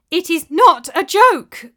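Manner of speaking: loud, high, slow protest; frustrated cry. A narrator's reading. Widow crying out in frustration at the scoffing monk, loud. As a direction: push back hard in frustration. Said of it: "cried Elisana in frustration".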